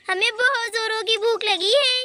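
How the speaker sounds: ordinary talk, nothing marked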